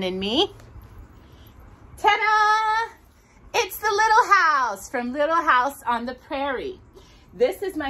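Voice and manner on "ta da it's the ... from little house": high, bright ta-da reveal; excited reveal. Real, not acted. Host unveiling the special calendar to viewers, a high bright ta-da. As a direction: unveil it with delight.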